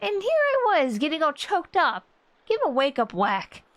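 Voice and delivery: high, chirpy sidekick teasing the sleeper; perky girl voice. Acted. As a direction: pop the lines up high like a cheerful young girl. Reading young companion's lines.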